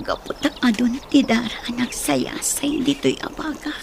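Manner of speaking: faint whispered prayer; dying whisper. Acted. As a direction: pray with last breath. (pabulong a kararag)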